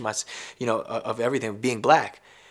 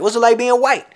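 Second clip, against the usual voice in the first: mocking interviewer; 10 semitones higher, 9 dB louder than usual. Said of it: "they like".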